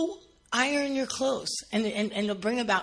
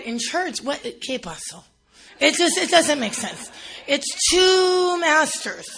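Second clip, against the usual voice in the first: usual speaking voice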